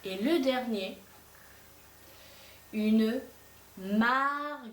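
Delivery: teacher dictating words one by one; word dictation. Shown as a demonstration. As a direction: dictate carefully to the class. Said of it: "vous allez m'écrire".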